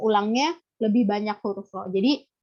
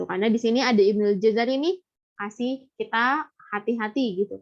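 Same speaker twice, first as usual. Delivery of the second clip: ordinary delivery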